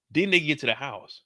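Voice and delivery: usual speaking voice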